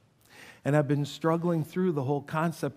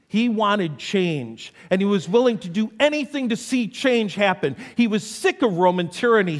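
fervent, rising, louder preaching; preaching at full force; 7 semitones higher, 6 dB louder than usual. Acted. Pastor preaching Judas's story, fervent and rising. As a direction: drive the story home to the congregation.

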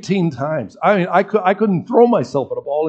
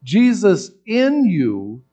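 slow, loud, higher preaching cadence; 5 semitones higher, 4 dB louder than usual. Retold or imitated. Professor preaching to the class, slow, loud and higher. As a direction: drive the point home from the pulpit. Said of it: "Everything that I just preached".

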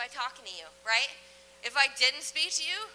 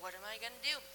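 quick, much softer, lower mock-helpless mumble; helpless worrier; 6 semitones lower, 12 dB quieter, faster than usual. Preacher mocking anxious Christians who don't know what to do, mock-helpless. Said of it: "I'm just like".

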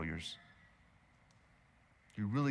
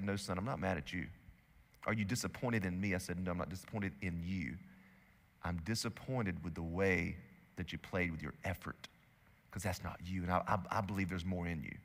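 gentle reassurance; 7 semitones lower, 8 dB quieter than usual. Retold or imitated. Father reassuring his son.

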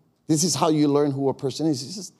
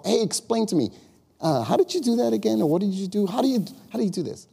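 raised, curious "explain to me"; curious asker; 6 semitones higher than usual. Shown as a demonstration. Demonstrating a question to listeners, curious and raised. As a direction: play the eager student. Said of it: "It's called".

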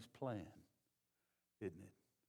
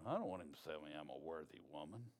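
much lower, quick, sassy put-on voice; mock wife's protest; 7 semitones lower, 7 dB quieter, faster than usual. Preacher voicing a wife brushing off the compliment, low and quick. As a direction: fire back as a wife who's not impressed. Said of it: "Some of y'all are going".

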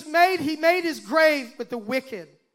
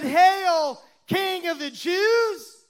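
raised-pitch mock homage, drawn out at the end; 5 semitones higher, much slower than usual. Soldiers mocking Jesus as king, mocking. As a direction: hail him in mockery, stretching the title. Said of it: "they mocked him".

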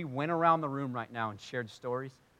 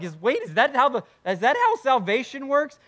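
higher, louder incredulous question; startled objection; 10 semitones higher, 9 dB louder than usual. Acted. Imagined listener questions how salvation works, incredulous. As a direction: blurt it as a baffled challenge.

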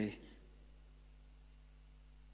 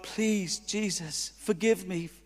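quiet prayer; 8 dB quieter, slower than usual. Retold or imitated. Silent prayer to God.

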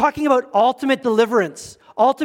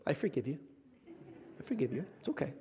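much softer, gentle mock absolution; mock forgiving aside; 6 semitones lower, 18 dB quieter, faster than usual. Pastor teases couples with outdoor weddings, soft mock pardon. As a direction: murmur forgiveness as a joke. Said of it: "those of you who have done that".

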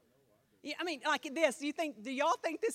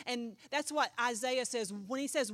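ordinary talk

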